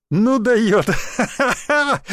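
loud, high burst dissolving into laughter; roaring with laughter. A narrator's reading. Onlooker amused by the newcomer's retort, roaring with laughter. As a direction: crack up while exclaiming. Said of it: "заливался от смеха ещё один".

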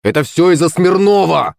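high-pitched, indignant outcry; accusing cry. A narrator's reading. Boy points at his rival, accusing cry. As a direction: cry out the blame. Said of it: "крикнул Коленька".

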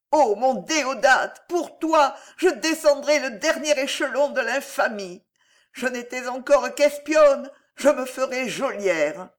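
high, loud, tragic declamation; wild impassioned vow. A narrator's reading. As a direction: swear it desperately to the absent lover. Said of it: "gronda-t-elle, échevelée, terrible". (serment exalté, désespéré)

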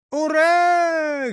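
lone loud high hurrah; a single shouted hurrah. A narrator's reading. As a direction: call out the cheer late. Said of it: "крикнул один Ниссе".

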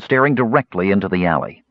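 ordinary delivery

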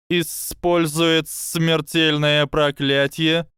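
high, slow serpent hiss; a sly snake, slow and calm. Acted.

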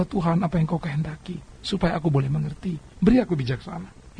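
soft, quiet prayer; humble prayer. Retold or imitated. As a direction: ask for wisdom gently. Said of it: "Engkau boleh berdoa dan berkata".

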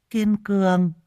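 high, odd parroting of the name; mimicking. A narrator's reading. Madwoman parrots the baby's name back, high and giggly. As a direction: parrot Kiên Cường back like a simple-minded woman. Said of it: "nói nhại theo".

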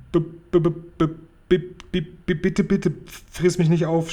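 high, trembling stammer on the b; frightened stutter. A narrator's reading. Sophie begs the giant not to eat her, high frightened stutter. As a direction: stutter in terror, a small girl begging. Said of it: "stotterte Sophiechen".

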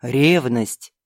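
low, mocking demon count. Count voiced in story. (низкий насмешливый голос демона)

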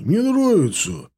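high, drawn-out goofy enthusiasm; eager crook. A narrator's reading. Лёня keenly recommends film to grumbling partner.

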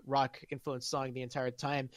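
ordinary talk

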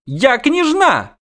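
very high, drawn-out princess protest; squealed insistence. Acted. Princess insists on her rank, squealed insistence. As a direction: stretch it into an indignant squeal.

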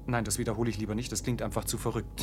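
muttered aside. Acted. Official muttering aside to himself.

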